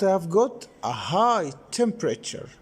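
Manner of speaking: ordinary delivery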